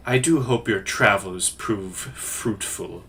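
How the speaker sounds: low, quiet parting blessing from a Father; soft-spoken priest. Acted. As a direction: send them off softly, voice dropping low. Game master voices Father Larkalai.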